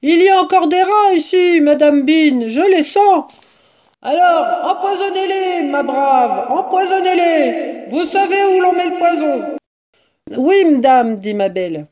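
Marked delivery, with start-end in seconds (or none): 0.0-3.3 high, loud complaint; shouting up. A narrator's reading. Woman reports rats to mistress, shouting. 4.0-9.6 high, projected command; calling down. A narrator's reading. Mistress orders rats poisoned from upstairs, calling down. 10.4-11.2 high, loud acknowledgement; calling up. A narrator's reading. Woman obeys mistress upstairs, calling up.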